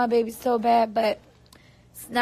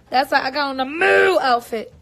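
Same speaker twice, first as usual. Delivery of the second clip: ordinary talk, nothing marked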